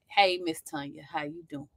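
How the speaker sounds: soft, low Southern greeting; gentle, courteous Southern hello; nice Southern voice. Pretend. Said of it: "I put on my nice Southern voice".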